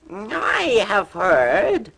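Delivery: high, very slow brave front; falsely brave cat. A narrator's reading. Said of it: "said Puss, looking far braver than he felt".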